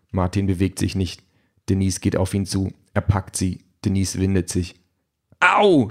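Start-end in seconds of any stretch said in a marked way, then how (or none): 5.4-5.9 high, loud yelp of pain; pained cry. A narrator's reading. Wife grabbed by husband at the door, crying out. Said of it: "schreit sie".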